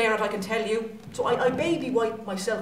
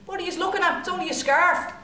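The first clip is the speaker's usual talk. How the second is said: defensive retort; 6 semitones higher, faster than usual. Retold or imitated. Defensive retort to staring strangers.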